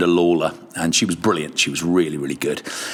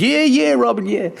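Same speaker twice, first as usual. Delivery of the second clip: mock [name] humouring his host; [name] impression. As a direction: brush it off breezily as [name]. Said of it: "He goes".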